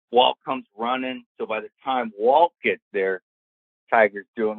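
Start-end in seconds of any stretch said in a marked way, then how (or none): none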